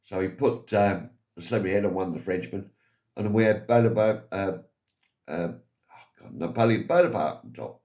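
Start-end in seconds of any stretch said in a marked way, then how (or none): none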